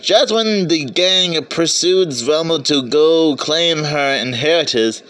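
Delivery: normal talking voice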